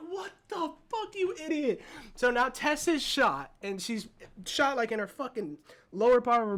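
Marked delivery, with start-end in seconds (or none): 0.1-1.8 outraged disbelief. Retold or imitated. Viewer reacting to a stupid move in the film, outraged disbelief.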